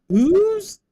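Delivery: "ooze" swooping up high and loud; rising end. Shown as a demonstration. Streamer showing chat how the end of "shoes" goes up. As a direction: slide "ooze" way up like a question. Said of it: "at the end going up".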